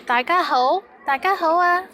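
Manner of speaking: high, cheery greeting to listeners; greeting a group. Shown as a demonstration. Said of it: "say I'm talking to you guys".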